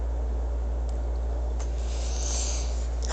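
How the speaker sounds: long, soft exhale; exasperated sigh. Real, not acted. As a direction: sigh out your frustration quietly. Fed up with others' ignorance.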